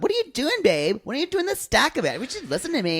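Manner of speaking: high sing-song babe voice; sugary, playful bossing; sweet girlfriend voice. Retold or imitated. Imitating Emily bossing him.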